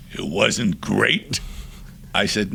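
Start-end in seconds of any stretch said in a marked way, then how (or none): 0.1-1.4 slow, disbelieving question, rising on GREAT; narrow-eyed challenge. Retold or imitated. Offended singer repeats the director's verdict, slow challenge. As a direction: echo it back slowly as an offended question. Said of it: "his eyes narrow".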